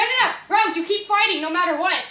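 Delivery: ordinary talk, nothing marked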